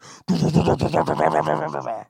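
loud, rapid-fire stuttered noise; imitated rumbling noise. Storyteller mimicking the minivan scene, loud sputtering noise. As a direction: rattle out a loud burst of mouth noise.

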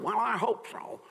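high, mocking imitation of a limp reply; mock doubtful hoping. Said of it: "You ever give somebody a word?".

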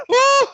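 high, loud imitated grunt; mock commentator grunt. Guest commentator reacting at the desk, high mock grunting. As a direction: yelp out a lecherous "huh". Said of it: "the only thing you'd hear over the commentator desk".

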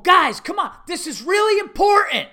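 high, loud coach shouting; overwrought coach. Retold or imitated. Coach pleading with little kids, overwrought shouting. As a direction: shout as if it were life or death. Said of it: "this guy's going".

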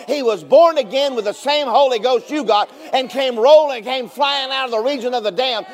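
loud shouting preacher build; full-force preaching. Acted. Preacher to church about resurrection, shouting.

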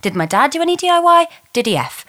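usual speaking voice

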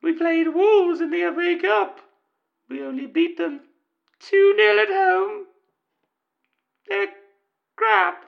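angry football fan in a high mock female voice; falsetto woman caller. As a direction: squeak it out like a fed-up lady fan.

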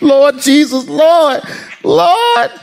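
high, rising cries of Lord; a scared kid calling on the Lord; mock panic. Kid fearing mama.

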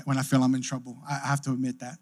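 ordinary talk, nothing marked

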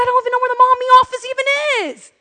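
high-pitched, held whine; whining with attitude. Retold or imitated. Mom whining at the receptionist, high with attitude. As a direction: whine it high right to the end. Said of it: "caught myself catching an attitude and whining".

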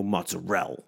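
MOZZARELLA cut off after the L, "mozzarell"; South Philly "mozzarell" with the ending dropped; clipped final vowel. Retold or imitated. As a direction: stop "Mozzarella" dead on the L, no final A. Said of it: "stop it there".

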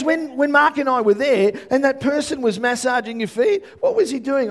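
high, loud imitation of an eager friend; excited retelling. Preacher voicing a friend explaining to him, excited.

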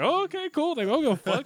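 high, breezy put-on voice, who cares; mock careless reply. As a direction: toss it off like nothing matters. Acting his own reply in line.

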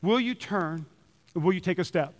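soft, earnest question; a pastor's softened plea to the congregation; quiet plea. Real, not acted. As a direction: ask it gently, almost hushed. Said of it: "passionate plea".

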